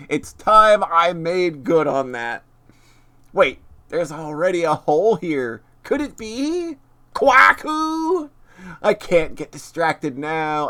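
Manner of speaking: dramatic story read-aloud; reading game text. Acted. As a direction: narrate the cutscene with flair.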